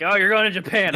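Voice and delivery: a hint of a cheerful grandpa; mock grandpa. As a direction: play the old man, matter-of-fact. Grandpa voiced again.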